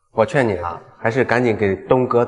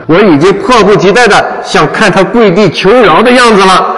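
gloating laugh; 5 semitones higher, 4 dB louder than usual. Acted. Sycophant gloats, laugh heard.

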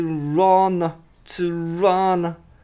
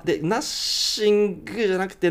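ordinary talk, nothing marked

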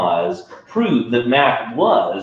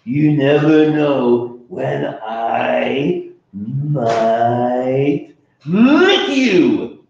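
mock terrifying; 3 semitones higher, 4 dB louder, much slower than usual. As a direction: try hard to sound scary. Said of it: "trying to sound terrifying".

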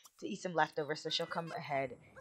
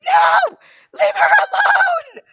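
very high, loud scream; frantic shout; 16 dB louder, slower than usual. Real, not acted. Player shouts at the game characters to leave her alone, frantic high shout. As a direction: scream it to call someone off.

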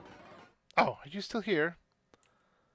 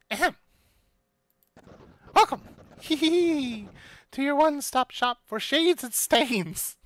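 high, giggly little shopkeeper; tiny excited voice; 8 semitones higher, 6 dB louder than usual. Acted. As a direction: giggle and pitch the shop eagerly. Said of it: "clearly tiny and excited".

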